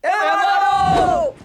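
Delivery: drawn-out shout to attack; urgent, long-held yell; shouted order. Acted. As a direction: shout the order to beat him. Beating scene, shouted order.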